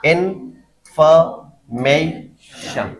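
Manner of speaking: Information split slowly into in, fa, may, shan; a clear gap after each syllable of information. Shown as a demonstration. Teacher counting syllables for students, slow and separated. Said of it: "तो कितने syllable हो गए?".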